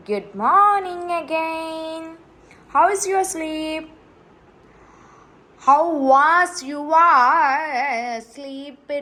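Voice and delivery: very slow, drawn-out high coo. Real, not acted. Stretched baby talk greeting.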